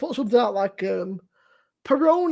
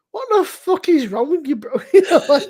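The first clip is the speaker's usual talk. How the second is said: laughing disbelief; 5 semitones higher, 4 dB louder than usual. Real, not acted.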